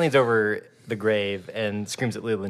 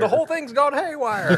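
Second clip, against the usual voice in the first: high, loud mock-alarm yell; hammed-up cry from off screen; off-screen bystander; 6 dB louder than usual. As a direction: holler it way up high with a laugh in it. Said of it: "off screen is like".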